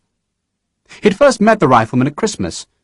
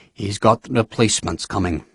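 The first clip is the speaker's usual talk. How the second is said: softer, dry mocking repeat; weary sarcastic echo; 3 semitones lower, 6 dB quieter than usual. A narrator's reading. General echoes Sharp with weary sarcasm, soft. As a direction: echo him wearily. Nairn repeats with eyes closed.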